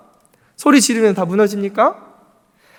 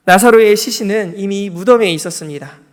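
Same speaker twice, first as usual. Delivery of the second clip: ordinary talk, nothing marked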